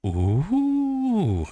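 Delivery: long high swooping "ooh"; high surprised "ooh". Real, not acted. Reacting to own ancestry results.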